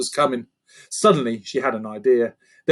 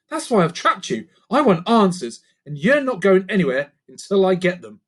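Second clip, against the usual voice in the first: put-on higher, determined girl; Lucy, firm; 6 semitones higher, 4 dB louder than usual. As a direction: lay down the law. Lucy demands answers.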